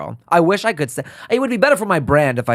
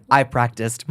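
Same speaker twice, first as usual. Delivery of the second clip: usual speaking voice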